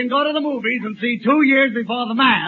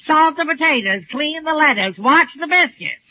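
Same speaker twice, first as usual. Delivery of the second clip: higher-pitched mock nagging; imitating nagging wife; 4 semitones higher than usual. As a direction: reel off orders as the nagging wife. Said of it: "I could hear my wife nagging".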